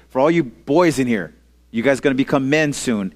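normal talking voice

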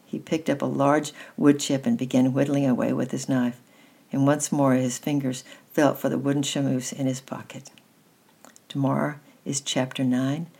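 plain reading voice

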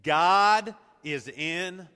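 loud, slow and emphatic; leading congregational repeat. Shown as a demonstration. Preacher leads the congregation to say it with him, loud proclamation. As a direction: proclaim it word by word, drawn out. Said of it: "Say that with me".

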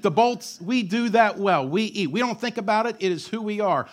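normal talking voice

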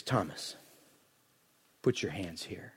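gentle invitation. Acted. Jesus calling Thomas to touch his wounds, tender hush. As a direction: speak to Thomas as a gentle friend.

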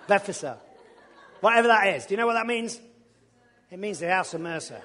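ordinary delivery

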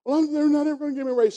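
high, fast whiny daughter; upset daughter. Retold or imitated. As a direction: rattle off the complaint again. Daughter repeats complaint.

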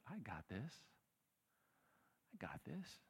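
hushed, calm assurance; soft reassuring God. Acted. God quietly reassuring the preacher, hushed and calm. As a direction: say it softly, settling things. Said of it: "except God says".

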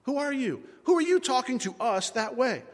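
high-pitched affronted demand; indignant challenge. Acted. Abner to the unknown caller, indignant.

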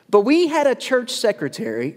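ordinary talk, nothing marked

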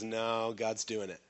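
low, soft, unbothered reply; calm assurance. Acted. Preacher playing Hezekiah answering the pressure around him, calm assurance. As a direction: quietly settle it, voice dropped. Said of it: "He goes".

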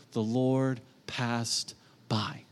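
slow reverent whisper; hushed whisper. Acted. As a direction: whisper it slowly, in awe. Reverent reading of Scripture.

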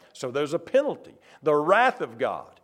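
usual speaking voice

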